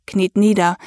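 ordinary delivery, nothing marked

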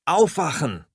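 high, loud wake-up yell; panicked wake-up cry; shout. Acted. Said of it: "schreie ich noch einmal".

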